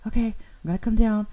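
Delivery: imitating a frantic mother; flustered mom. Mom on the phone, flustered but reassuring. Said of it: "She's like".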